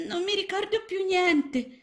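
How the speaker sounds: put-on very high, small child-frog voice; a tiny lost frog, unsure; little frog. A narrator's reading. Lost little frog answering Mrs Balzelli, high and small.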